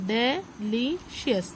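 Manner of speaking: "delicious" very slowly as de, li, cious with gaps; teacher's slow three-part breakdown of "delicious"; syllable by syllable. Shown as a demonstration. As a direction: sound out "delicious" in three separate beats. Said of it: "three syllable word हो गया".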